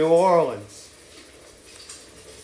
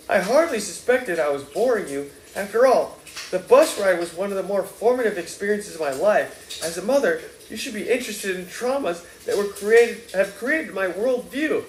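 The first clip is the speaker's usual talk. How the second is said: mildly hurt, self-important; lightly voiced wounded Ignatius; 7 semitones higher than usual. A narrator's reading. Son complaining to his mother that he is hurt, lightly voiced.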